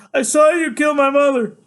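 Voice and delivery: high, loud cry of accusation; shouted accusation. Acted. Son answering father's demand, high shouted accusation. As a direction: shout it straight at him.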